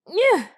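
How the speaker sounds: high loud pained yelp; pained squeal. A narrator's reading. Kicked in groin.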